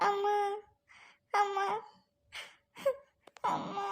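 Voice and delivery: sobbed calls with long breaks, fading away; weeping for mother. Acted. Orphaned girl calling her dead mother, weeping. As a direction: cry out for your mother, weaker each time. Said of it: "ஏம்மா அழுகுற?".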